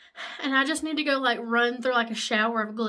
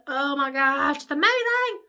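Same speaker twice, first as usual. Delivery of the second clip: excited squeal; 2 semitones higher, 7 dB louder than usual. Real, not acted. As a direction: shriek with joy at the lipstick. First sight of lipstick.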